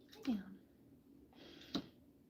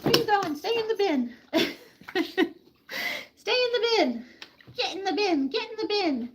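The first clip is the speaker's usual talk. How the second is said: high, louder calls to a pet escaping; chiding a pet climbing out of its bin; high scolding; 9 semitones higher, 3 dB louder than usual. Real, not acted. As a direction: call the pet back firmly.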